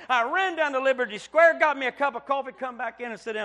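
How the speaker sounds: loud, high, triumphant preaching; shouting preacher. Acted. Preacher telling the church he found the dime, shouting. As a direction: shout the testimony out.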